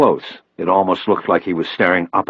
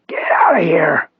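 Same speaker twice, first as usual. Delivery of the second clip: slow, forceful high rasp; strongest rasp; 10 semitones higher than usual. A narrator's reading. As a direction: order them out through a strained voice. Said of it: "his voice the clearest and strongest".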